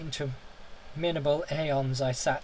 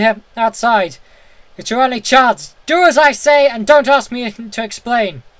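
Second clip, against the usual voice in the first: much higher and louder, frantic pleading; wild consternation; 9 semitones higher, 15 dB louder than usual. A narrator's reading. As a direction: beg him to flee, near panic. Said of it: "in a pitch of wilder consternation".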